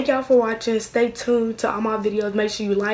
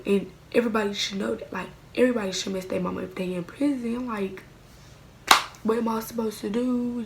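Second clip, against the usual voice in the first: ordinary talk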